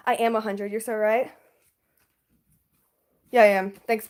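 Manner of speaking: ordinary talk, nothing marked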